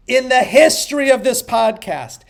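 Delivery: normal talking voice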